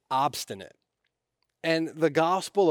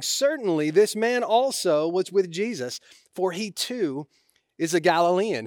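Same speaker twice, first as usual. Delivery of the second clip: higher, louder, mildly acted accusation; insistent; 6 semitones higher, 5 dB louder than usual. Bystander accusing Peter of being with Jesus, mildly insistent. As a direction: read it as a quoted bystander insisting. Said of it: "still another insisted".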